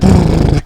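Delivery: quick blown gun noise with the mouth; mouthed gunfire. Retold or imitated. Retelling Rambo shooting the bad guy, mouthed gunfire. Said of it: "pull out the gunshot, shoot him".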